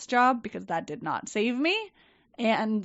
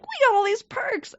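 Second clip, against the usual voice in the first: much higher, sing-song parroting; sarcastic echo; 13 semitones higher, 3 dB louder than usual. Retold or imitated. Staff repeating the company line, in high sarcastic parroting. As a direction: parrot it back with hollow glee. Employees echoing the boss.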